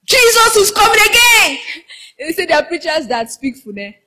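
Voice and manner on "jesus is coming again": high-pitched, hammed-up fervent cry; mock-fervent preacher, pitched right up. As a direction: mimic a preacher crying out with fervour. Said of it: "preachers that are very fervent".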